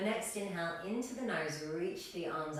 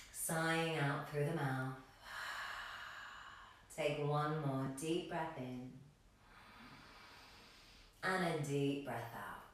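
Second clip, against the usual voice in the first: guided meditation; 4 semitones lower, 4 dB quieter than usual. Real, not acted. Yoga teacher guiding the class to arrive in practice, calm meditation voice. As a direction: settle them into stillness with long pauses.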